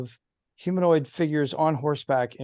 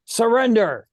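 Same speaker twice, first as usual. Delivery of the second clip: loud commanding shout of surrender; a prince's loud shouted command; shouted demand; 7 semitones higher, 6 dB louder than usual. Retold or imitated. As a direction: bark it as an order to yield. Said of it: "you can hear the voice of Prince Humperdinck saying".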